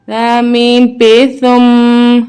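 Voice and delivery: ثم slow, the doubled م hummed long; ghunna on meem. Shown as a demonstration. Teacher shows children the ghunna on the doubled meem. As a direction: hum the doubled م in ثم slowly. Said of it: "میم مشدد پہ ہم غنہ کریں گے".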